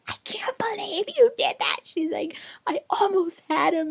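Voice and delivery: high, strained mock screech; retold outraged woman. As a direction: squeal it like an outraged woman in a fight. Retold fight, woman raging at him.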